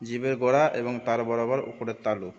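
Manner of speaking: normal talking voice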